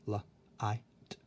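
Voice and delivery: "la, I, t" as three separate sounds with gaps; sounded out. Shown as a demonstration. Teacher showing you can't hear the G H, sounding out. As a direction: sound out light piece by piece: "la, I, t". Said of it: "You hear".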